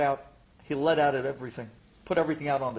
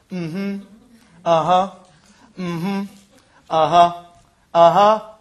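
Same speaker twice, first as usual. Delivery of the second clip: mocking, repetitive listening noises; mimicked therapist acknowledgments; 11 dB louder, slower than usual. Patient mimicking the doctor's replies, mocking therapist. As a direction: parrot the doctor's empty prompts, some punched loud. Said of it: "All I heard from you for a half an hour was".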